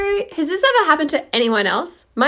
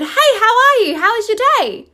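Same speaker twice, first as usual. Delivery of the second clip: retold greeting pitched much higher; chirpy high hello to his wife; husband's cheery high-pitched hello, imitated; bright higher greeting; 10 semitones higher, 4 dB louder than usual. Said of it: "and said".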